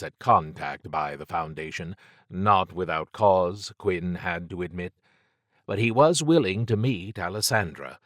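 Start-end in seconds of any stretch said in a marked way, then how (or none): none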